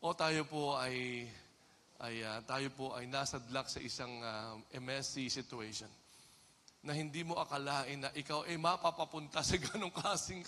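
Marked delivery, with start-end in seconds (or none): none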